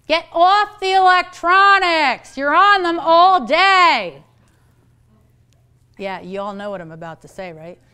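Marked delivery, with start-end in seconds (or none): 0.1-4.2 put-on slow, loud, higher-pitched nagging at the kids; scolding parent. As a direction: bark it out like a fed-up mom. Parent scolding kids about screens.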